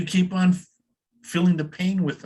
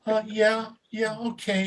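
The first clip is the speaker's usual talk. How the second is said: hesitant, higher unsure voice; timid newcomer; 6 semitones higher, 3 dB quieter, slower than usual. Retold or imitated. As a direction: mimic a shy first-timer. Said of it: "they're like".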